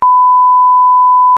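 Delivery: shrieking high laugh burst; burst of laughter. Real, not acted. Gossiping about a fart to the interviewer, loud shrieking laugh. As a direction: let out a loud squealing laugh. Hearing a classmate rip one.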